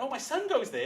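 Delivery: high, loud chirpy voice; delighted nurse. Retold or imitated. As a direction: chirp it like a proud mum.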